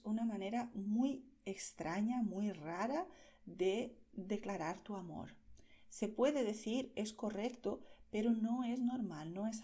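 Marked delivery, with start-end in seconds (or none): none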